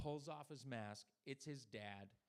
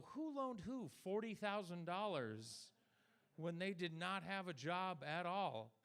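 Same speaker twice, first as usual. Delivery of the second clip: slow, higher, emphatic outrage; indignant retort; 4 semitones higher, 3 dB louder, slower than usual. Retold or imitated. To the loan company, slow indignant outrage. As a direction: hammer each word at the lender. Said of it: "And I go".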